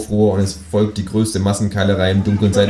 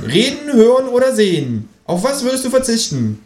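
very high creature voice; kangaroo character. A narrator's reading. Kangaroo posing a hypothetical, very high-pitched. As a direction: pose it in a high cartoon animal voice.

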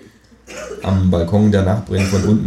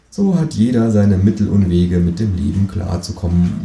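usual speaking voice

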